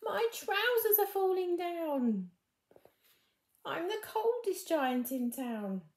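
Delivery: high, loud and slow comic cry; comic wail. Acted. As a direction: ham up the giant's dismay for laughs. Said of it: "my boys always used to really find funny".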